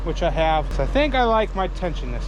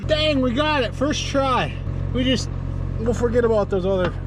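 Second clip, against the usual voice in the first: excited; 6 semitones higher than usual. Real, not acted. Lifting the track into place.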